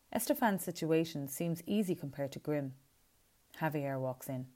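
usual speaking voice

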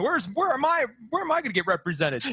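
mock indignation, pitch jumping up at the start.

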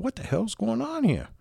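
high, puzzled inner voice; bewildered, asking yourself what's going on; baffled thought. Retold or imitated.

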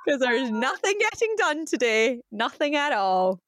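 playful proclamation with a drawn-out finish; mock announcement. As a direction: jokingly declare the whole day cancelled.

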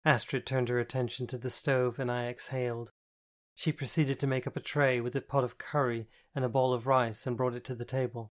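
ordinary delivery

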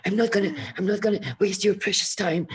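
high, fast, loud star-struck gushing; gushing excitement. Retold or imitated. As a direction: gush at your hero, breathless with excitement. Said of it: "my voice was shaking".

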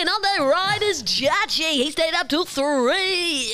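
big mock fight introduction; ring announcer.

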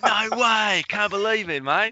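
high, excited Aussie voice; Australian accent. Retold or imitated. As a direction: do a lively Australian bloke. Imitating Australian fans.

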